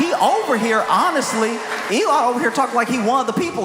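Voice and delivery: exasperated comic yell; incredulous shouting. Acted. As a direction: call him out in disbelief.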